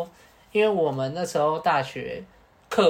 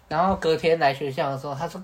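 usual speaking voice

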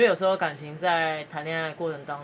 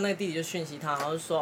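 ordinary talk

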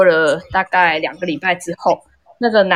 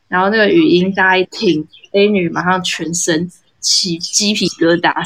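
ordinary delivery

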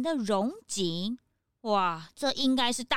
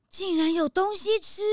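higher, bright exclaim of disbelief; can't believe there's food; delighted surprise; 5 semitones higher than usual. Acted. Hungry guest hears food is offered, delighted surprise.

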